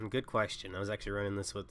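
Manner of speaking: ordinary talk, nothing marked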